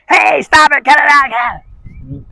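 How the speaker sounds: high, loud imitation of an owner yelling at the dog; mock frantic yelling. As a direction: ham up a panicked owner shouting at the dog, pitched high. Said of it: "A lot of times people start doing this".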